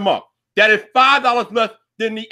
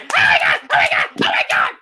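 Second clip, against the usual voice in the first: higher, breathless mock fan squeals; mock cheering crowd; 13 semitones higher than usual. Mocking the audience at Tim Cook's keynote, hammed-up squeals. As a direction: imitate a crowd gushing over nothing. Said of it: "these train monkeys will be yeehaw like".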